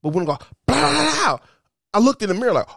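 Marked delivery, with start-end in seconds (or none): 0.7-1.4 high, rattling explosion noise; big firecracker burst. Retold or imitated.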